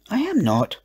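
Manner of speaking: high-pitched, mildly indignant denial; indignant hiss. A narrator's reading. Edward answering his sister's jibe, mildly hissed. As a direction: deny it crossly in a child's pitch. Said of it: "hissed Edward".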